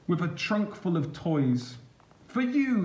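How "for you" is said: put-on much higher, beaming child's offer; proud little one showing off his gift. Little one hands toys to his mother, beaming. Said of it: "he beamed".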